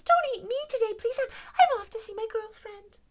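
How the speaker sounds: very high, loud pleading mouse; frightened mouse. Acted. Mouse begs lion not to eat him, frightened mouse. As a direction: stammer a high, urgent little plea. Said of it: "said the mouse".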